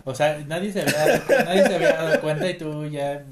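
loud, fast laughing words. Real, not acted. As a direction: blurt it out laughing. Laughing fit.

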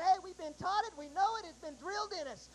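ordinary delivery

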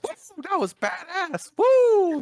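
high, whooping cheer that climbs to a loud woo; hyped-up yell of victory after the crash; imitating a friend screaming woo; excited yelling. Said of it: "he started yelling".

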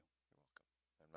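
breathy whisper. Real, not acted. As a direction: whisper it under your breath. Soft aside after thanks.

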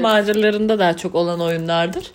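normal talking voice

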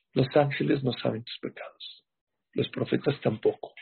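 normal talking voice

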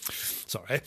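ordinary delivery

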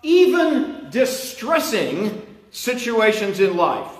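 high, slow, fervent sermon delivery; preaching. Acted. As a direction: preach each phrase with building fervour. Preaching at full force.

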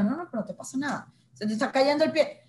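lightly voiced person waving off a falling arm; no big deal, mocking it; dismissive voice. Mocking someone dismissing an injury.

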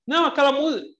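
voz bem mais aguda, imitando um menino que insiste; insistência infantil encenada. Retold or imitated. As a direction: insista em tom alto, como criança que não foi entendida. Menino insiste com o rabino. (protesto agudo de menino, encenado)

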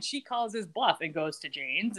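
usual speaking voice

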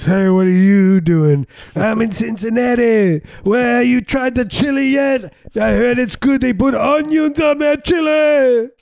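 very high, loud, drawn-out monotone holler; mock shouted call through a baby monitor; boys on baby monitors. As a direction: holler slowly on one high held pitch. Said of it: "Two boys speak through baby monitors".

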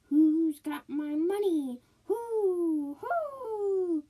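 high, loud, drawn-out ghostly wail. A narrator's reading. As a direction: moan it out like a dead woman searching for her money. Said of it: "It was a voice crying".

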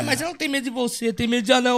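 high, fast mock voice; quoting the friend. Friend says she fears dwarfs, high fast mock. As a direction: parrot her excuse.

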